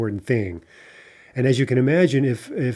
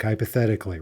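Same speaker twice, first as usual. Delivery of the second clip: ordinary talk, nothing marked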